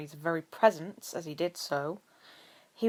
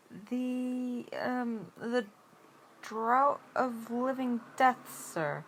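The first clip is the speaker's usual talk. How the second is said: hesitant, higher, halting answer; uncertain; 6 semitones higher, much slower than usual. A narrator's reading. Nervous pupil answering his professor, hesitant and higher. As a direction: grope for the answer, unsure. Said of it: "he replied uncertainly".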